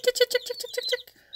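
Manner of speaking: high, loud tick-tick chicken call. Real, not acted. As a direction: call the hens in with ticking. Louder chicken call, higher.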